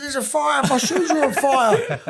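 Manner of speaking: put-on high voice, laughter creeping in; imitating a workmate. Workmate reporting his shoes alight, imitated high alarm. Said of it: "this is how he talks".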